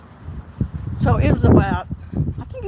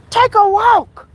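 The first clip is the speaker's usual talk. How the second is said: much higher, louder command; barked order; 9 semitones higher, 9 dB louder, slower than usual. Retold or imitated. Lieutenant ordering angry officer away, loud firm command. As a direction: shout him off the scene.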